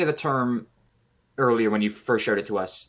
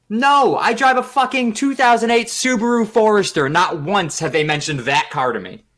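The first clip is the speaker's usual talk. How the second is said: exasperated retort; 10 semitones higher, 7 dB louder, slower than usual. Retold or imitated. Retorting to a spam caller.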